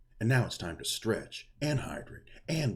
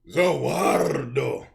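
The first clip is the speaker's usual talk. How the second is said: villain battle cry; 8 semitones higher, 11 dB louder, much slower than usual. Pretend. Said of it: "I can try to go".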